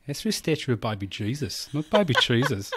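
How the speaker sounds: put-on Australian sitcom accent; Kath and Kim. Quoting the sitcom to a co-host, Australian accent. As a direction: do broad comic Australian vowels. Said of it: "Do you Kath and Kim it?".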